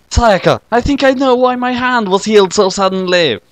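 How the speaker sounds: a hint of a happy boy; lightly voiced boy. Kyosuke greeting his visitor, lightly voiced boy.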